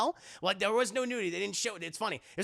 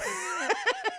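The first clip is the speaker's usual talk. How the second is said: high mock laughter; imitated laughing. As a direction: laugh as the eavesdropper, put on. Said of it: "he's, like, laughing".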